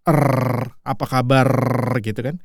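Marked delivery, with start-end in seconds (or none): 0.1-0.7 "Er" as one long trilled R; rolled R. Shown as a demonstration. Teacher demonstrating the rolling R, long trill on "Er". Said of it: "diputer gitu ya". 0.8-1.5 "kabar" ending in a long trill; rolled R. Shown as a demonstration. Teacher showing the Indonesian R, long trilled end on "kabar". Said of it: "diputer gitu ya".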